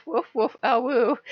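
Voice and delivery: pouting god doing silly wolf sounds; mock wolf noises. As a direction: bark and howl like a wannabe wolf. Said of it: "he's like".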